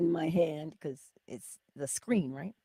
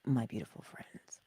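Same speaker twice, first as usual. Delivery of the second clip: soft whisper to the viewers; 7 semitones lower, 6 dB quieter than usual. Real, not acted. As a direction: close the thought in a hush.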